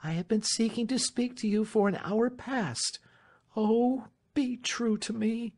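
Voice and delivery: high-pitched passionate appeal; Marian, entreating. Acted. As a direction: plead for loyalty, slowing on the last words.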